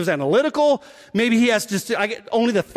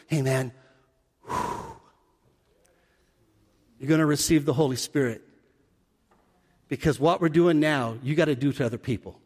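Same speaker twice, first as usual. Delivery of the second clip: low, soft, breathy-free hush; hushed Jesus; 8 semitones lower, 5 dB quieter than usual. Retold or imitated. Jesus giving the disciples the Spirit, low and soft.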